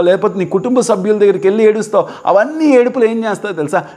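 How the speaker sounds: high-pitched, driving litany of reproach; impassioned rebuke. Real, not acted. Preacher rebukes crying before people. (ఆవేశంగా, పై స్వరంలో మందలింపు)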